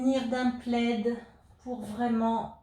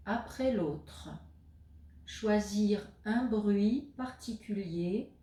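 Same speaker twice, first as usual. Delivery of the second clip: guided meditation; 3 dB quieter than usual. Acted. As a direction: guide the listener into stillness, leaving space between phrases. Guides a yoga nidra session. (voix posée de méditation guidée, avec de longues pauses)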